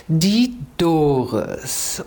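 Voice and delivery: very slow, savoured name reveal; drawn-out introduction. Real, not acted. Host presenting her guest to the listeners, drawn-out introduction.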